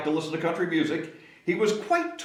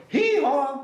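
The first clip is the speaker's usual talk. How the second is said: high, loud braying hee-haw; donkey bray; 12 semitones higher, 5 dB louder than usual. Shown as a demonstration. Reading to children, loud high donkey hee-haw. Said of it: "Let's say that together".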